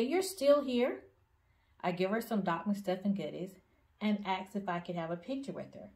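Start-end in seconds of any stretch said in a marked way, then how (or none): 0.0-1.0 playful surprise. Retold or imitated. Playful doctor returning to child.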